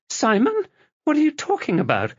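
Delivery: high, fast frightened protest; alarmed. Acted. Wife frightened by her husband's grip, high and fast. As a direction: voice climbing in panic.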